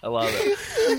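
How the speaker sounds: laughing through words; words breaking into laughter. Real, not acted. Laughing at haiku.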